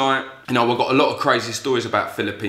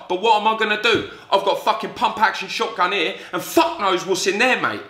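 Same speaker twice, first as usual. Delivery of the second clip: high-pitched, agitated reasoning; retold helpless protest; 7 semitones higher than usual. Passenger facing an armed guard, helpless protest. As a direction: argue it out high, as if cornered. Cornered, reasoning he has no choice.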